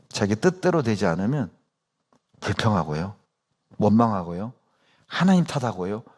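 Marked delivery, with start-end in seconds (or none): none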